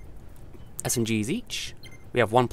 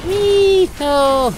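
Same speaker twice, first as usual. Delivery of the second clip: high squealing wee then no; panicked high yelp as things go wrong; scared giddy yelp; 9 dB louder than usual. Real, not acted. Game going badly, panicking.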